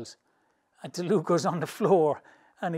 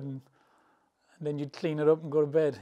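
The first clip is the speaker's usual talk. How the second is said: ordinary talk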